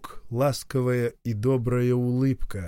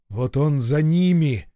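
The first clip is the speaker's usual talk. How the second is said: ordinary delivery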